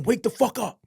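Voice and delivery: high, harsh mock guard yell; guard rousing a prisoner at three in the morning, mock yell; guard barking order. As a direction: bark it like a guard waking an inmate. Guard wakes him at 3am.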